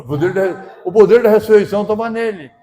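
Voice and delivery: loud, rising preacher's cry; preaching climax. Real, not acted. Preacher to the church, shouting. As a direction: let the power of the resurrection ring out.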